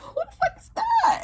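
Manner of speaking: very high, laughing disbelief; shocked laughing squeal. Retold or imitated. To viewers, reliving shock at a masked stranger, laughing squeal.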